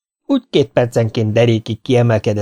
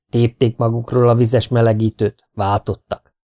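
plain reading voice

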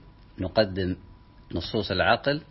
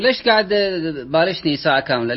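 higher, louder, fed-up complaint; exasperated outburst; 8 semitones higher, 7 dB louder than usual. Retold or imitated. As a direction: vent at wasting an hour of arguing. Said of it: "قال،". (احتجاج غاضب بصوت أعلى)

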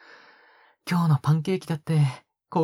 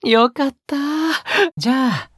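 high, louder burst of relief; delighted relief; 12 semitones higher, 4 dB louder than usual. Acted. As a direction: brighten up, suddenly high and happy. Relieved that listener agreed to eat. (高い声でほっと喜ぶ)

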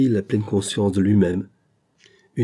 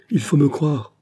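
higher, forceful cry; violent outcry; 6 semitones higher, 3 dB louder than usual. A narrator's reading. Dying man forcing the engineer to believe him, fierce cry. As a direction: fling it out fiercely. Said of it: "s'écria ... avec une sorte de violence".

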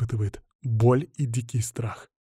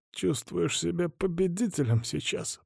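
slow, soft, higher-pitched strain; breathless, through teeth; 5 semitones higher, 7 dB quieter than usual. A narrator's reading. Hostage taunting his captor while suffocating, strained. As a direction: squeeze it through clenched teeth. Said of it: "процедил, снова начиная задыхаться".

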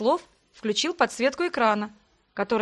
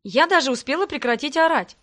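plain reading voice

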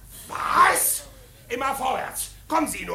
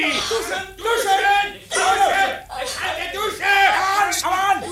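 betrunken schimpfend; 3 semitones higher, much slower than usual. Acted. Betrunkener Streit, Beschimpfung.

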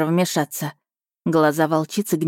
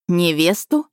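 rising, disbelieving question; incredulous echo; slower than usual. A narrator's reading. Heroine hears she is a fiancée, incredulous. Shocked echo of engagement news.

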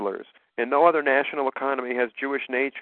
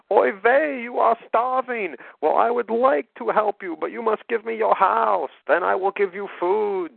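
put-on high caricatured Yiddish accent voice; 10 semitones higher than usual. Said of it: "they saw a Southern family".

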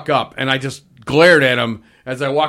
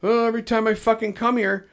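mock whining complaint, slightly higher and softer; higher-pitched grumble, lightly put on; retold griping; 5 semitones higher, 5 dB quieter than usual. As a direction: imitate a customer griping. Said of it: "she starts bitching about".

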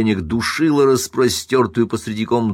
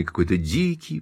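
ordinary delivery, nothing marked

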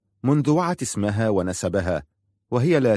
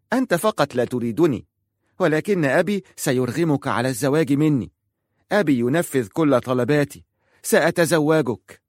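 put-on high, stubborn child's voice; a spoilt girl digging in, pitched high, slowing on the last word; defiant child voice, higher; insistent little girl; 6 semitones higher than usual. A narrator's reading. Girl insisting she'll marry him.

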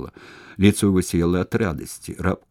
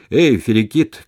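loud, higher-pitched call; 5 semitones higher, 8 dB louder than usual. A narrator's reading. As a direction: shout to someone passing in the street. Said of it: "крикнул зеленщик".

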